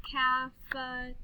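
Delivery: stretched-out "Caf-e-te-ri-a"; clapped syllables. Shown as a demonstration. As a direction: break "Cafeteria" into five level beats. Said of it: "then we'll clap the syllables".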